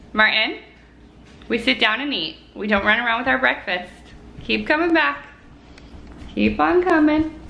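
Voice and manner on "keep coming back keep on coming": high coaxing encouragement; encouraging call. Real, not acted. Coaxing toddler toward her.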